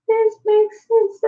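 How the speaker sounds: high, slow amused realization; mock revelation. Thinking in the doctor's office, high and amused. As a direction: pitch way up as if suddenly seeing the light.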